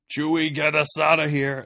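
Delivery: hammed-up urgent command; mock yell. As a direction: call out over sled dogs, imitating the hero. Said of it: "That's what he yells at the dogs".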